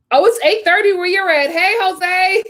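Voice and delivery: high, delighted shout-out; high-pitched, perky hello; excited greeting. Real, not acted. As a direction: greet a favourite viewer, voice lifted high. Greeting a viewer who joins.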